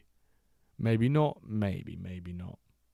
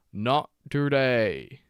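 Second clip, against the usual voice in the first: savoured taunt; 3 semitones higher, 4 dB louder, much slower than usual. Acted. Savoured repeat after a save.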